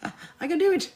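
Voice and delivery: high, loud joyful whoop; excited cheer. Pretend. Viewer cheering after finishing their first quilt, excited whoop. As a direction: cheer like you just nailed it. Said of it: "You literally would be like".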